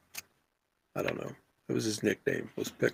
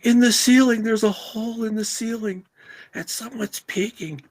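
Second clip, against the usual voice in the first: high, panicked voice, fading toward the end; a scared woman pointing up, voice dropping by the last words; frightened woman; 13 semitones higher, 5 dB louder than usual. Retold or imitated. Woman in the dressing room explaining in a high panic. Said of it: "And she's like".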